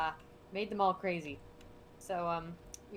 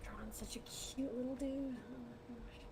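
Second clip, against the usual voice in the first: whisper; 3 semitones higher, 8 dB quieter than usual. Real, not acted. Whispering over drawing.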